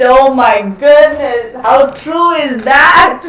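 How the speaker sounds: slow, high, loud laughing exclamation; laughing hard. Real, not acted. Streamer cracking up at a viewer comment, laughing hard.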